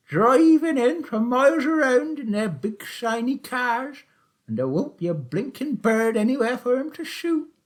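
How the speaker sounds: put-on high, loud old countryman's voice; sly old taxi driver. A narrator's reading. Old Charlie teasing Willum about the shoot, high and loud.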